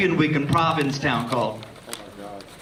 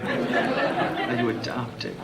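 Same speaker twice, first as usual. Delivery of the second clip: low breathy mocking whisper; 10 semitones lower, 6 dB quieter, much slower than usual. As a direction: whisper it back slow and sly.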